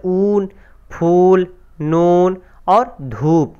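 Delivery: ऊन, फूल, नून and धूप with the ऊ drawn out long; stretched vowels. Shown as a demonstration. Teacher shows students the stretched vowel, slow and drawn out. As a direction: pull out the long ऊ in each word. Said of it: "आवाज़ खींच के इसमें पढ़ी जाती है".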